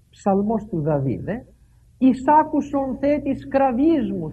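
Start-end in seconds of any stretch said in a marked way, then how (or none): 2.0-4.3 slow, loud, raised plea; slow pleading cry. Retold or imitated. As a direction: call out to God slowly. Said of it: "φωνάζω σε σένα". (αργή, δυνατή ικεσία)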